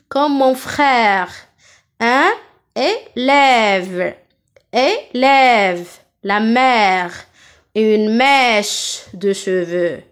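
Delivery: slow, stretched è in "frère", "mère", "forêt"; open è. Shown as a demonstration. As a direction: open wide and hold the è of "élève" and "rivière". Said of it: "maintenant j'ouvre ma bouche". (è ouvert et allongé, comme dans « frère »)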